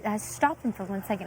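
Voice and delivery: ordinary delivery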